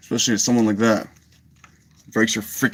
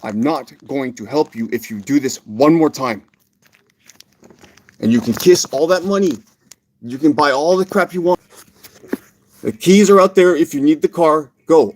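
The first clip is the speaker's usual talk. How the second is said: much louder and higher, yelling at her; furious shouting; 5 semitones higher, 8 dB louder than usual. Real, not acted. Furious man yelling at Kathy about the money, real shout. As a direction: blast her with real anger.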